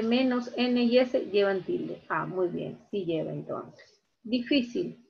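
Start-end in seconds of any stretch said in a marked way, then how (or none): none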